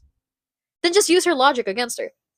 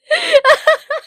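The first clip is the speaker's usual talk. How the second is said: loud, high cackle; high-pitched laughing fit; 6 dB louder than usual. Real, not acted. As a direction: burst out laughing after the punchline. Laughs at own punchline.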